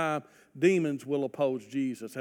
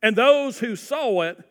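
louder, higher preaching read; fervent pulpit reading; forceful scripture reading; 5 semitones higher, 5 dB louder than usual. Acted. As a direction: build the story with energy.

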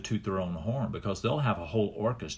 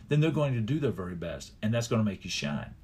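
ordinary talk, nothing marked

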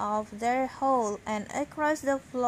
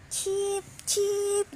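high, bright bird cheeps; canary chirp; 8 semitones higher than usual. A narrator's reading. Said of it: "the canary said".

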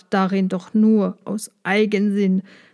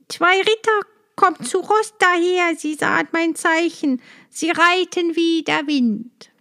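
higher, eager lookout voice; sister's relief; 7 semitones higher than usual. A narrator's reading. Sister on the tower announcing rescue, high and eager. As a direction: call out the good news. Sister sees the brothers coming.

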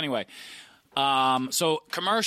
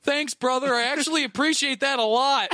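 high, dazed stoner thanks; 11 semitones higher, 5 dB louder than usual. Retold or imitated. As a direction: play a blissed-out stoner. Stoned dudes thanking him.

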